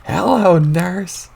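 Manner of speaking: put-on high, loud old-man whoop; loud, high folksy catcall; old-timer exclamation. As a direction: belt it like a delighted old geezer. Old grandpa exclaims while powering up.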